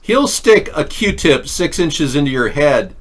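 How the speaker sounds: normal talking voice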